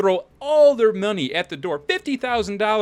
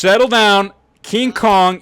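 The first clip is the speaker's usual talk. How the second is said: louder, slightly higher command; loud settle down; 4 semitones higher, 7 dB louder, slower than usual. Real, not acted. Lawyer ordering the noisy courtroom to settle down, loud.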